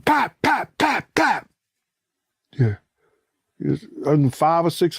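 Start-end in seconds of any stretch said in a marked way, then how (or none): 0.1-1.5 high popping pap sounds; quick mouth gunshot pops; imitated gunshots. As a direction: mimic the gunfire.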